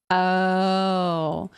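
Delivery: ordinary talk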